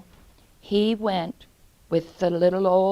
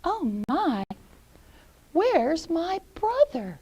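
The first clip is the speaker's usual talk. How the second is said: lightly voiced little girl, higher; a touch of worry, higher pitch; puzzled Gretel; 8 semitones higher than usual. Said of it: "and she goes".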